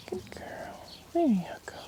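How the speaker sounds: hushed, gentle calming; soft whisper. Real, not acted. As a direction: whisper soothingly to the horse. Soothing a horse while grooming.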